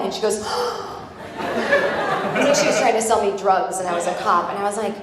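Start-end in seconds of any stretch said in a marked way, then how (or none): 0.5-1.3 sharp high gasp of shock; mock horrified gasp. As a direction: gasp like you just caught someone. Shocked gasp in acted scene.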